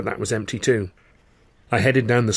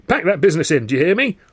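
louder, higher stern telling-off; scolding through door; 7 semitones higher, 4 dB louder than usual. A narrator's reading. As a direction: scold them to stop the noise. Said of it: "George hissed from the landing".

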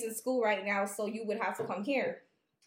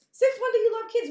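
indignant disbelief; 10 semitones higher, 8 dB louder than usual. Real, not acted. Outraged at boyfriend's claim.

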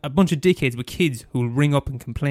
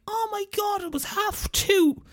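hammed-up fussy parent, much higher; mock outraged parent; 14 semitones higher than usual. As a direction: squeak it like a clutching-pearls mum. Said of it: "'cause, like".

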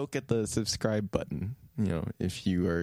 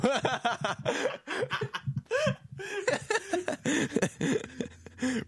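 high laugh. Real, not acted. Cracking up.

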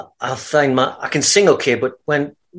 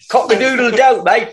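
high crowing in mock Cockney; Cockney accent; 9 semitones higher than usual. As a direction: crow like a London rooster with a Cockney accent. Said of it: "that Cockney accent in there".

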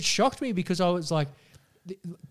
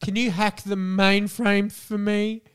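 mock coy girlfriend, higher pitched; coy girl; 5 semitones higher, slower than usual. Podcaster mocking a coy girlfriend asking for help, mock coy. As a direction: play dumb and helpless, pitched up. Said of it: "just acting a bit coy and just being like".

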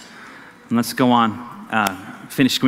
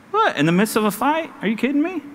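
rising, pitched-up what-are-you-serious; mock disbelief; 6 semitones higher, 3 dB quieter than usual. Pastor playing a stunned listener, mock disbelief. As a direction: act out the crowd's amazement.

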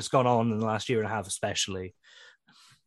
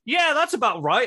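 mock approving; 12 semitones higher, 9 dB louder than usual. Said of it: "Emsy's just like".

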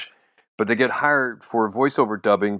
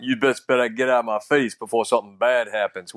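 lightly voiced urban American street accent; full urban. Comic quoting his Barack Obama bit, urban street accent. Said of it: "just snapping and just going full urban".

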